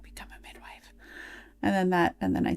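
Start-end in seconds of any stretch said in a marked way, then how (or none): 0.0-0.9 hushed grandma whisper. Retold or imitated. Dying grandma whispers to her granddaughter, soft whisper. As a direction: breathe it softly like a last wish. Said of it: "She's like".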